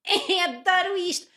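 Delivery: high, slow, sugary delight; fake blissful mom. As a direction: gush like a diaper ad mother. Mocking happy mothers in ads. (mãe de anúncio, falsamente encantada)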